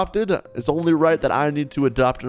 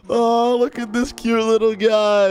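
cooing; 7 semitones higher, slower than usual. Real, not acted. Cooing over cute kid.

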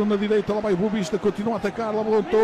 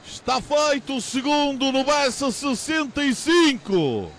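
excited commentary; 4 semitones higher than usual. Real, not acted. After the goal, still excited.

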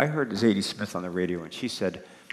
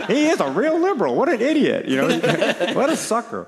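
much higher and louder, laughing mockery; jeering opponent; 14 semitones higher, 9 dB louder than usual. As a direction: sneer and laugh like you've won. Acts hardened listeners jeering at him.

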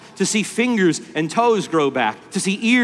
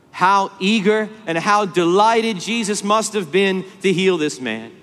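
ordinary delivery

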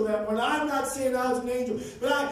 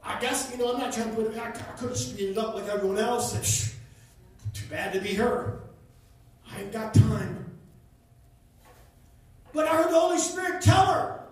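ordinary talk, nothing marked

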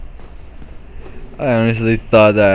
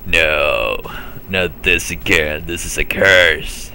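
a lower mock despairing voice; lament voice; 5 semitones lower, slower than usual. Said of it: "he's like".

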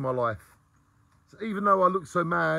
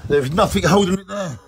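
loud; 2 semitones higher, 10 dB louder than usual. Real, not acted. Loud proclamation to camera.

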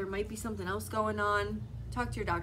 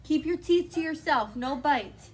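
stern scolding; 6 semitones higher, 7 dB louder than usual. Real, not acted. Scolding biting child.

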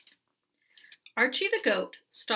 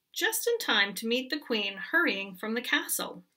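plain reading voice

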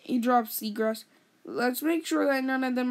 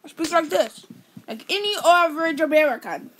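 put-on lightly done British accent, a bit higher and louder; mock British accent; 5 semitones higher, 6 dB louder than usual. Brother teasing in a hammed British accent. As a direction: tease in a Brit voice.

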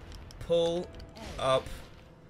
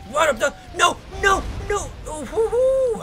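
loud, high yelps turning to a wail; panicked cry; 7 dB louder, slower than usual. Real, not acted. Gamer watching his move go wrong, panicked wailing. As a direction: cry out as it all goes wrong.

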